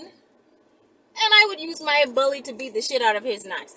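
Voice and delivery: usual speaking voice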